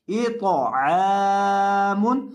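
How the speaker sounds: अश्तातन read very slowly with each syllable held; drawn-out reading. Shown as a demonstration. Teacher showing students the whole word read slowly. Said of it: "teacher reads the whole word".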